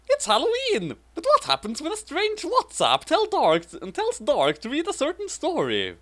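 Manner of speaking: high, overblown storybook voice for a silly fanfic; hammed-up reading. Reading the fanfic description to her co-host, hammed up. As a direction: read it like a breathless Halloween trailer. Reads fanfic aloud dramatically.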